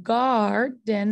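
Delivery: "garden" slowly, stretching the "gar"; drawn-out ar sound. Shown as a demonstration. Teacher showing the class the R sound, "garden" slow and split. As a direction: stretch "garden" into GAAR-den. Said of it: "that makes that R sound".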